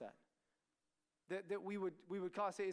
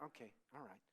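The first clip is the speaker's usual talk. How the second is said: much lower, softer; deflated parent; 9 semitones lower, 14 dB quieter, faster than usual. Acted. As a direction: quietly give in.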